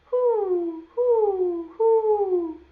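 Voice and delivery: put-on high, loud owl hoots for the kids to copy; owl hooting. Shown as a demonstration. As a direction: hoot like an owl, showing the children. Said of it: "Can you say that?".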